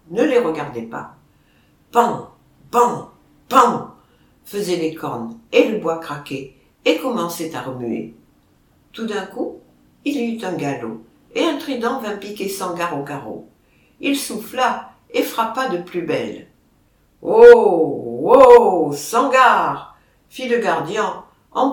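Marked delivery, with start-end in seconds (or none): 1.9-3.9 imitated bangs. A narrator's reading. Horns striking the beam. 17.2-20.0 loud herdsman's call across the pen. A narrator's reading. Herdsman shouting at the charging bull, loud call.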